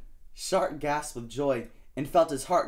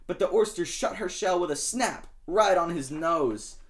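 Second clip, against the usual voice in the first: usual speaking voice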